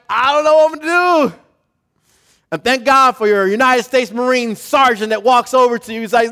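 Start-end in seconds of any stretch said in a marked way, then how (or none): 0.1-1.3 high, loud panicky cry; frantic rushed worry; panicked soldier. Retold or imitated. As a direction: voice a scared young soldier.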